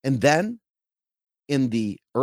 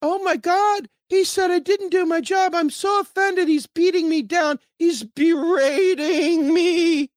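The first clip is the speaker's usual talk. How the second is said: much higher, whining mock outrage; whiny offended voice; 14 semitones higher, 3 dB louder than usual. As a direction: whine like someone deeply offended. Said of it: "people going".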